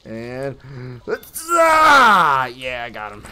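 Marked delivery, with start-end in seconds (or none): 1.5-2.5 high falling battle scream; loud scream. Real, not acted. Player attacking an enemy, high loud scream. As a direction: let out a long loud yell.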